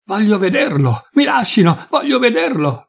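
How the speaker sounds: high-pitched, desperate pleading; tearful frantic cry. A narrator's reading. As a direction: act out a distraught woman demanding to get through. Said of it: "gridava piangendo e smaniando Adriana". (grido disperato e smanioso)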